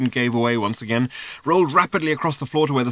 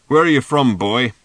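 put-on louder old man's question; gruff old man asking; 9 dB louder than usual. A narrator's reading. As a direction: call him boy, a bit louder. Seer questions Arthur.

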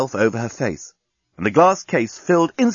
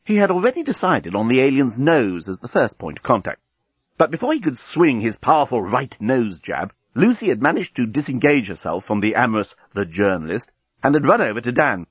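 usual speaking voice

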